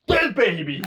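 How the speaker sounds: loud, high sudden yell; punchy shouted interjection; mock outburst. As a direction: blurt it out loud into the silence. Blurts mock gag into silence.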